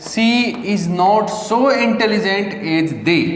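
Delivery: very slow, each word spaced; slow dictation. Shown as a demonstration. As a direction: read it out slowly for students to copy. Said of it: "teacher dictates slowly".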